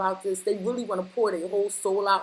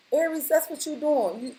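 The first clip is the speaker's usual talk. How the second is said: ordinary talk, nothing marked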